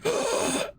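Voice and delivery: sharp gasp. Acted. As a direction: gasp like coming back to life. Character revives on the beach.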